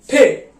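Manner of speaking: ठे with a hard aspirated t; German te. Shown as a demonstration. Teacher demonstrating German T as ठे. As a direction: say ठे, not टी. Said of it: "लेकिन German में हम क्या बोलते हैं इसको".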